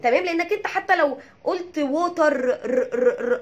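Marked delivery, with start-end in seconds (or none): 1.8-3.4 "ووتر" with the final r trilled over and over; wrong rolled R. Shown as a demonstration. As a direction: exaggerate the mistaken rolled r of "ووتر". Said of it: "غلط".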